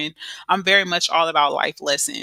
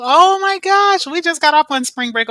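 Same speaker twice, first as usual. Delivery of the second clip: excited; 9 semitones higher, 7 dB louder than usual. Real, not acted. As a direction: burst out in delight. Sudden exclamation about spring break.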